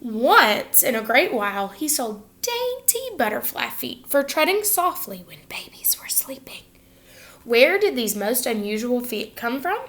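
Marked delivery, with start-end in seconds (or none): 5.3-6.6 whisper. A narrator's reading. Babies sleeping, treading softly.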